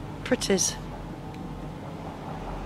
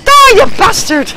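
slow furious shout, higher and louder; angry yell; 11 semitones higher, 8 dB louder than usual. Real, not acted. Gamer fighting an enemy, a slow furious yell. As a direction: spit it out as a battle cry. Player attacks enemy in game.

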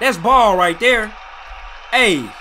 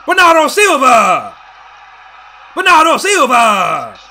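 slow, stretched goal scream; long goal yell; 2 semitones higher, 6 dB louder than usual. Real, not acted. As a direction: bellow the scorer's name at the screen. Goal scored, yelling at TV.

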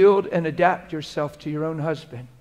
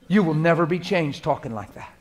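ordinary delivery

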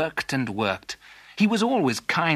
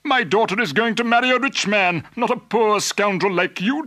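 high, loud, outraged rebuke; indignant father; 8 semitones higher, 5 dB louder than usual. Acted. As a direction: scold the unworthy suitor at full voice. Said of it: "cried Mister Fitzwarren".

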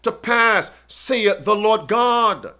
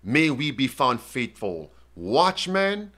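solemn prayer; 7 semitones lower, 6 dB quieter than usual. Acted. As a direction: pray over the congregation, hushed and earnest. Closing prayer after the presentation.

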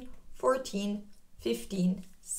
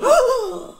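high, loud pretend gasp of fright; mock gasp; 13 semitones higher, 8 dB louder than usual. Teacher pretending fright for the children, loud high gasp. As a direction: gasp as if scared for the kids.

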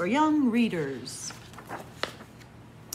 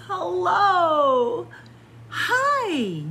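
much higher and louder hello, hi; joyful greeting; 10 semitones higher, 6 dB louder, much slower than usual. Acted. Reading to kids, the chameleon joyfully greets new friends. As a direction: burst with delight at friends.

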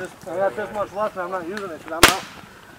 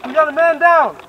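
loud urgent callout to the team; man down shout; 6 semitones higher, 9 dB louder than usual. Real, not acted. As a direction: yell it across the field. Calling out in paintball game.

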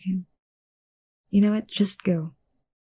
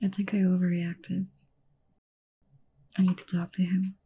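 soft private whisper, softer; whispered to herself; 5 dB quieter than usual. Acted. Alone, before going to find him, a soft whisper. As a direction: murmur it to yourself, half-regretful.

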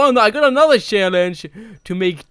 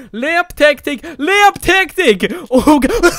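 excited shout; 6 semitones higher, 7 dB louder than usual. Real, not acted. Excited shout while playing.

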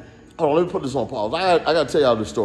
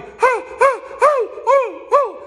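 very high, loud hooting scream; imitating a man hooting in panic on a dropping plane; mock panicked hooting; 3 dB louder, slower than usual. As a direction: hoot like a terrified man on a plane. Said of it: "this dude back there was".

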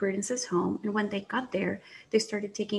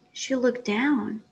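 plain reading voice

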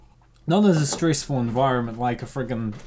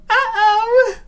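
high, loud startled cry; surprised; 9 dB louder than usual. Acted. Assistant notices her clothes tightening.

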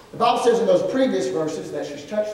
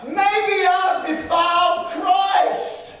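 fearful cry; 10 semitones higher, much slower than usual. Acted. Voicing the woman's fear.

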